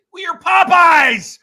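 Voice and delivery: loud, high exasperated shout; exasperated outburst. Retold or imitated. Customer told there is no chicken at Popeyes, exasperated shout. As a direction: yell it in disbelief, drawing it out.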